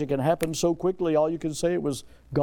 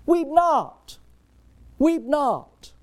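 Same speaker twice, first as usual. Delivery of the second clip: high, loud "Weep not" proclaimed; ringing command; 10 semitones higher, 4 dB louder, much slower than usual. Acted. Preacher voicing Jesus to the mother, high and loud. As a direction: call out "Weep not" with full authority.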